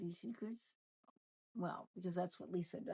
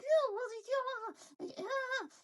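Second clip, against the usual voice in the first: mock Lisa, pitched way up; 5 dB louder, much slower than usual. Host imitates Lisa brushing off Heather's criticism, high mocking voice. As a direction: squeak out a dismissive brush-off as Lisa. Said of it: "She's like".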